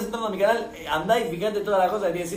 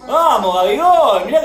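cheering shout; 5 semitones higher, 7 dB louder than usual. Real, not acted. Cheering a player.

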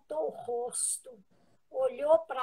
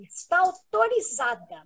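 much louder and higher, firm pronouncement; authoritative; 6 semitones higher, 13 dB louder than usual. Retold or imitated. Chico granting permission, loud and commanding. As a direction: ring it out like a final ruling. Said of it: "mas ele falou com autoridade".

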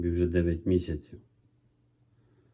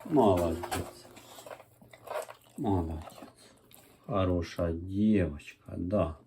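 praising a dog; 6 dB quieter than usual. Real, not acted. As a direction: gently praise the puppy.

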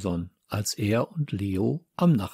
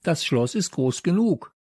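higher, emphatic agreement; calling out; 7 semitones higher than usual. A narrator's reading. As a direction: chime in with a raised voice. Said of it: "rief Leo".

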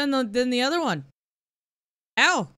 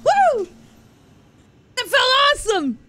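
high, loud, laughing disbelief; elated shouting; 9 semitones higher, 3 dB louder than usual. Real, not acted. Player celebrating a first parry, elated shouting. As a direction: whoop it out, thrilled.

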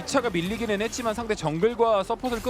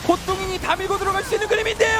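esports caster; 8 semitones higher than usual. Real, not acted. Caster calls a teamfight.

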